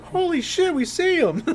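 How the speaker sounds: high, excited yell of surprise; excited shout. Retold or imitated. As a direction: squeal it like spotting a friend far off. Friends shouting excitedly, retold.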